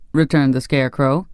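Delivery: usual speaking voice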